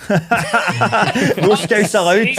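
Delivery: laughing mock sign. Mock reading an imagined sign.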